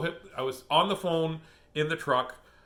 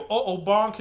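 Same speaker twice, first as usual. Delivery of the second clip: put-on playful toddler talk; 5 semitones higher, 6 dB louder than usual. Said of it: "I go".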